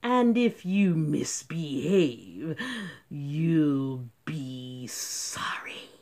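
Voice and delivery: slow, then low whispered menace; threat. Acted. Strict teacher threatening the class. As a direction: threaten slowly, trailing to a whisper.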